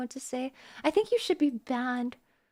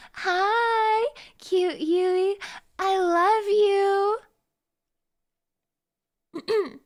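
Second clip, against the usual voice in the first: cutesy greeting; 6 semitones higher, 5 dB louder, much slower than usual. Acted. Cutesy message to Yui.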